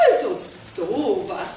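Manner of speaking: slow, scornful little fly voice; tut-tut, sneering; mocking. A narrator's reading. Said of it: "summte die Fliege spöttisch".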